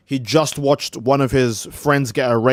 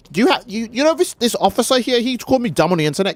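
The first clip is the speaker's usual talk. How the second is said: whiny complainer; 7 semitones higher than usual. Retold or imitated. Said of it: "be like".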